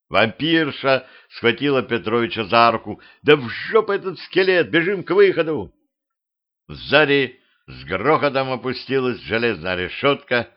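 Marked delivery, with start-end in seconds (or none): 3.2-5.7 high, insistent outburst; urgent. A narrator's reading. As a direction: urge him to run, voice pitched up. Vampiress urges escape from the station. (взволнованно, высоким голосом)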